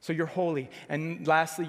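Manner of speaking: ordinary delivery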